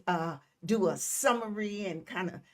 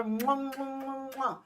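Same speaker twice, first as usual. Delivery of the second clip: blown kisses; 4 semitones higher, 5 dB quieter than usual. Real, not acted. Blows kisses to viewers.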